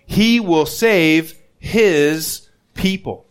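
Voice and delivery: He will save his people said very slowly, word by word; slow emphasis. Shown as a demonstration. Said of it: "look how specific it is too".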